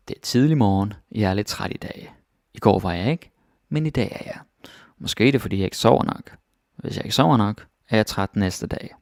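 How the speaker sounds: natural fast Danish; fast native pace. Shown as a demonstration. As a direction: rattle it off like a native speaker. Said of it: "Now that's kinda fast".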